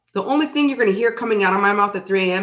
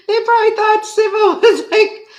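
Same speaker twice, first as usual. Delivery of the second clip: laughing; 12 semitones higher, 5 dB louder than usual. Real, not acted. Laughing at friend's mishap.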